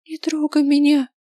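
high, shaky pleading cry; trembling plea. A narrator's reading. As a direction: beg him not to touch you. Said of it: "дрожащим голосом зачем-то попросил я".

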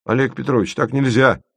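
a protesting cry; trembling exclamation. A narrator's reading. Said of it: "воскликнул".

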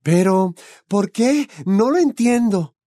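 flustered mother, lost; Molly baffled by the minister's visit; bewildered. A narrator's reading.

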